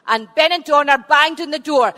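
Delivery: ordinary delivery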